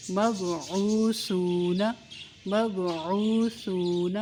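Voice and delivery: slow مبعوثون with a soft sa, no whistle; soft sa, stretched. Shown as a demonstration. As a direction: recite مبعوثون gently, no hiss on sa. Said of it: "سا کو بہت نرمی سے". (مبعوثون، سا نرمی سے، سیٹی کے بغیر)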